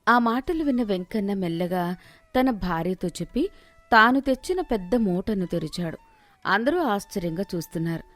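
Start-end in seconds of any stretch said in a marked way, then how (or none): none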